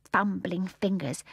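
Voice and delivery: ordinary delivery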